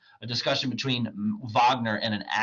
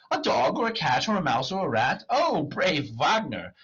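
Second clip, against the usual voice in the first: higher, amazed repetition; delighted echo; 7 semitones higher, 4 dB louder than usual. Acted. Clown marvels at Wagner's offer, excited. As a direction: echo the list in wonder, stretching the cheer.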